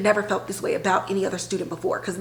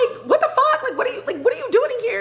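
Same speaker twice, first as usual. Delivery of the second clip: high, shrieking mock outrage with laughter; imitating a furious girlfriend; mock scream; much faster than usual. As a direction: screech it at him, half laughing. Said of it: "she's screaming at the principal".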